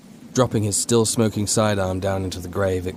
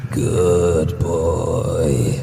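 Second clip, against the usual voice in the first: a lightly growled, mocking creature voice; a hint of a taunting monster; 3 semitones lower, 3 dB louder than usual. A narrator's reading. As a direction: undead mother lightly sneering at her son. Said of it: "the creature growled in a mocking tone".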